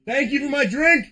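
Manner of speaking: high, loud holler; loud, high-pitched shout of thanks; called-out thanks. Real, not acted. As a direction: call it out to someone across the room. Calls out thanks for drink.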